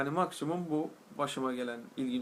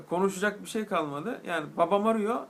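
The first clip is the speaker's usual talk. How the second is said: ordinary talk, nothing marked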